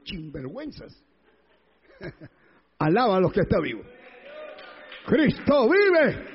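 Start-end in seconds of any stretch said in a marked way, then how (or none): 5.1-6.2 slow, loud and high cry; shouted proclamation. Real, not acted. Preacher shouting to the congregation that Christ lives. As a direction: proclaim it to the whole church.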